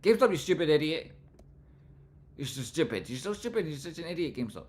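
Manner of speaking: loud mock scolding; mock shouting. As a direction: yell insults at GameStop, hammed up. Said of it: "shout at GameStop like it's an idiot".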